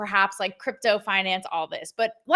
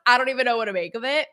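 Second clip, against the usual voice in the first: normal talking voice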